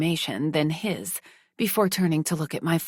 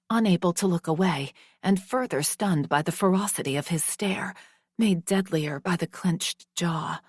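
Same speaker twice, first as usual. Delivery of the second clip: normal talking voice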